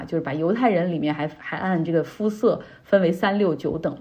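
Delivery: ordinary talk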